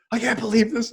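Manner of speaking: high, outraged whine of disbelief; indignant teen. Retold or imitated. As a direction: squeal it like a furious teenager. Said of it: "she gets so pissed off".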